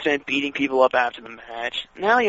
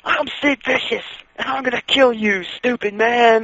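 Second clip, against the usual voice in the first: mock tough-guy promo, a bit higher and put-on; bad wrestler promo; 6 semitones higher than usual. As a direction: ham up a clumsy wrestling threat. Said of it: "Sid Vicious has an equally bad promo".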